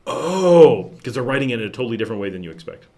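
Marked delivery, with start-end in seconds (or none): 0.1-0.9 loud, higher drawn-out "oh"; sudden realization. Pretend. Reader sounding out Nicaraguan writing, sudden realization. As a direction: act out the penny dropping. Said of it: "and then be like".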